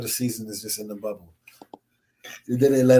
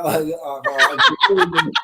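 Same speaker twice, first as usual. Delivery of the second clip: mock exasperated groan; 4 semitones higher, 4 dB louder, much slower than usual. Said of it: "I was like".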